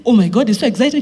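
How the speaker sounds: ordinary talk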